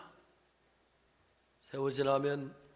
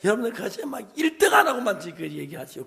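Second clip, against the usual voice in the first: usual speaking voice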